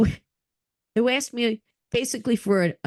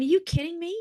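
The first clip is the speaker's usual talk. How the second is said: excited disbelief; 9 semitones higher than usual. Retold or imitated. Excited retold reaction.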